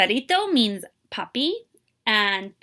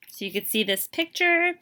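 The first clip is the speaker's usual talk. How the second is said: usual speaking voice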